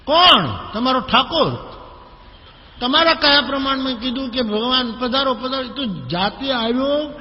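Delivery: high, loud furious rant about the god; angry outburst. Retold or imitated. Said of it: "બહુ ગુસ્સામાં આવ્યો".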